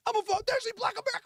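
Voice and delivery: high, indignant declaration; hammed-up angry announcement; mad. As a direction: protest in a high outraged voice. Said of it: "You get all mad".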